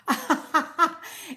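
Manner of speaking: short embarrassed laugh; laughing at her own confusion. Real, not acted. Laughing at the live chat's timeout button.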